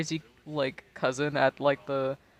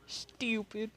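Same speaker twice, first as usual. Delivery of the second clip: "Stupid." said British style, higher and softer; 6 semitones higher, 8 dB quieter than usual. Retold or imitated. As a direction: say "Stupid." like a Brit, with no hard T. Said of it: "I love the way that you Brits say stupid".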